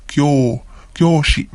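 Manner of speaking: Kyo held long, with a drawn-out o; lengthened o vowel. Shown as a demonstration. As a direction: stretch the o in Kyoshi. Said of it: "Again, another long vowel".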